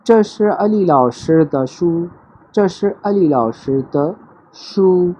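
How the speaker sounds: very slow, clear teacher demo; 这是阿里老师的书 said very slowly, syllable by syllable; slow model sentence. Shown as a demonstration. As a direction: spell out each syllable for learners. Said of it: "teacher models Chinese sentence slowly".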